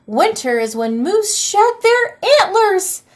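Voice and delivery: high, loud alarmed exclamation; alarmed cry. Acted. Troll crying out as he falls from the antlers. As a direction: blurt it out in panic.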